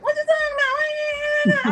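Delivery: high, slow whiny whimper; mock whimpering voice; imitating a whimper. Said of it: "his little whimper was like".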